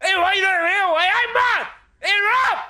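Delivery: high, very loud Geordie bellow; shouting Geordie bloke. Retold or imitated. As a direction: imitate a tipsy Geordie shouting across a queue. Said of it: "there was a Geordie bloke in the queue".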